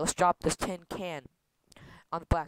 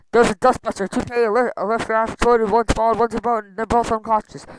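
loud, raised-pitch alarm; frantic report; 5 semitones higher, 8 dB louder than usual. Acted. Explaining the cockpit accident to Vanessa, frantic. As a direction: pour out the disaster loudly.

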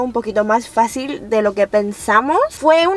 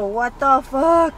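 normal talking voice

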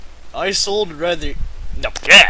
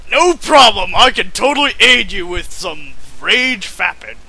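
loud, high, hammed-up reply from the hand; mock hand voice; 6 semitones higher, 10 dB louder than usual. The hand answers its owner in a loud hammed-up voice. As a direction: belt it out as the eager talking hand.

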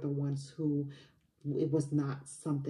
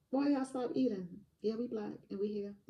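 sassy mock retort; 7 semitones higher than usual. Coach retells facing the stunned hotel guests, sassy mock retort. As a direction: tease the staring diners with attitude. Retold reply to staring guests.

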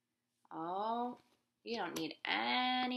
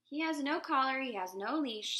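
louder, stern official warden; dog warden; 6 semitones higher, 8 dB louder than usual. A narrator's reading. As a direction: lay down the facts firmly. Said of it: "said the dog warden".

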